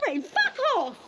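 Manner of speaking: fast, high-pitched angry tirade; outraged insult. Real, not acted. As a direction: hurl it back, voice high and rushed. Hurling insults back in argument.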